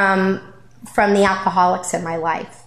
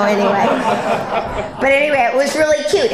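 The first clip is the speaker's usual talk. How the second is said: laughing it off, higher; embarrassed laughing; 5 semitones higher, faster than usual. Real, not acted. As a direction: giggle through the words. Laughs off her own story.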